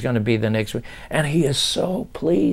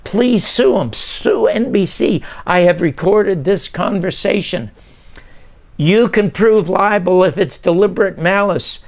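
high-pitched, distraught pleading; retold sobbing; 8 semitones higher than usual. Writer apologising by phone to the wronged man, imitating sobbing. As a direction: plead urgently, voice pitched up, as if near tears. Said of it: "I'm sobbing on the phone".

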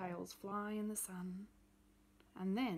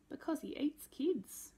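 put-on higher child's voice, a little louder; scared little girl; 6 semitones higher, 5 dB louder than usual. Rose telling her mum he eats kids, childlike and earnest. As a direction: Rose insisting, wide-eyed and sure. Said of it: "said Rose".

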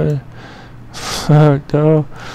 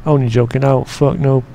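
ordinary talk, nothing marked

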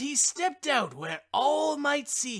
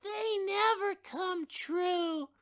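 put-on higher, slow, let-down childlike voice; sulky child; 10 semitones higher, 4 dB quieter, slower than usual. A narrator's reading. Child grumbling about failed wishes, high and slow. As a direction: complain in a disappointed drag. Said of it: "cried the other child".

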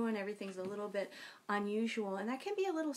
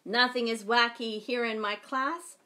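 storytime reading; 4 semitones higher, 6 dB louder than usual. A narrator's reading. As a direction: read it like a picture book to kids. Reads picture book aloud.

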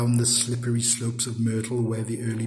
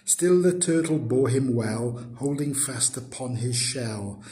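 plain reading voice

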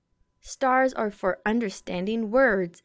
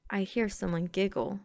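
usual speaking voice

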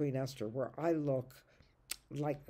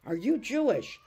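shocked; 8 semitones higher, 8 dB louder than usual. Acted. Boy shocked by news.

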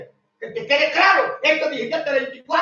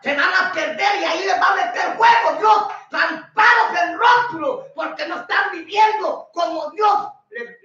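fiery preaching shout; 5 semitones higher than usual. Real, not acted. Preacher warning listeners of God's fire, shouting high. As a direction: thunder the threat of fire.

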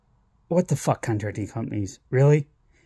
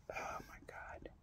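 whisper; 30 dB quieter, slower than usual. Real, not acted. Exasperated aside under breath.

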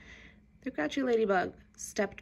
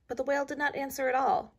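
ordinary delivery

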